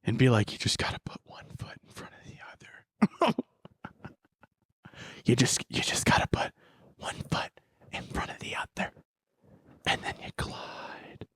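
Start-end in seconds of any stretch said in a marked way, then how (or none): 0.5-3.3 whisper. Pretend. Mock advice to glide on rollerblades. 5.2-11.3 whisper. Pretend. Whispered rollerblade coaching continues.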